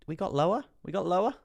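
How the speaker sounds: high, loud rising question; surprised question. Real, not acted. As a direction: ask it in high-pitched disbelief. Streamer checks a new low.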